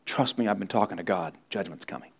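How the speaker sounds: low hushed warning; soft warning. Acted. Preacher voicing Noah warning onlookers, low and hushed. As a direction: say it low, like a secret you dread.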